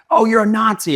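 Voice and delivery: high, loud taunting sneer at him; mock heckler. Heckler at his event yelling an insult, high and loud. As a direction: jeer it like a heckler in the crowd.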